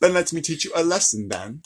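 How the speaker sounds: hammed-up British accent leaning Cockney. Shown as a demonstration. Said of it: "That was more Cockney".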